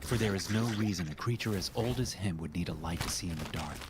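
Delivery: plain reading voice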